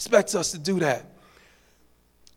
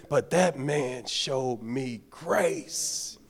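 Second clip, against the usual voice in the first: slow emphatic; 3 semitones lower, 5 dB quieter, much slower than usual. Real, not acted. Sermon key line slowed.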